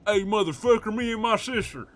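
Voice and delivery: put-on high, loud parody of an Alabama accent; mock Alabama accent. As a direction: put on an exaggerated Alabama twang. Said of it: "I'm not talking about no Alabama accent".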